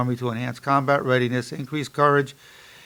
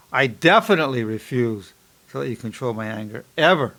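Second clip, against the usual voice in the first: punched "definitely", firm "ever" at the end; stress on definitely; 2 semitones lower than usual. Shown as a demonstration. Psychologist varying his power thought, emphatic "definitely". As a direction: drive home "definitely" with resolve. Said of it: "You can put what's called a variator".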